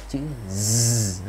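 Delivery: "Z" held as a long buzz; voiced Z. Shown as a demonstration. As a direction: draw "Z" out with the voice on. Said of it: "là hữu thanh".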